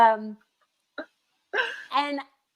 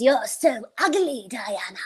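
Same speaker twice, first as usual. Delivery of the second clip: nagging shame voice. Acted. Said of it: "that nagging voice in my head".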